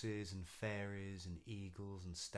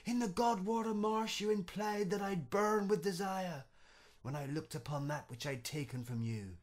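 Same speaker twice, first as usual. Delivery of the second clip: higher, loud furious accusation; angry roar; 11 semitones higher, 10 dB louder than usual. Acted. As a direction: rage at the knight. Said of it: "he roared".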